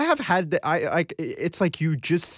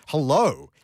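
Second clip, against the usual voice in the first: ordinary talk, nothing marked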